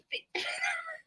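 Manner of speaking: high, breathless laughing squeal; squeaky crying laugh. Real, not acted. Laughing fit at the chat.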